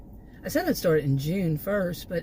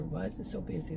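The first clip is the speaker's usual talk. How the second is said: usual speaking voice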